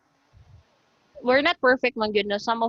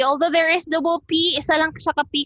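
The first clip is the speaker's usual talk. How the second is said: ordinary talk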